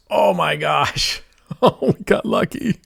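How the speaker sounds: high, laughing burst of relief at a lucky hit; excited win reaction. Real, not acted. Streamer reacts to a lucky win with laughing relief. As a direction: gasp it out, then laugh as the win lands.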